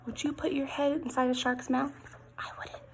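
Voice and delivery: breathless conspiratorial whisper. Acted. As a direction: lean in and whisper the scary question. Teasing question to children.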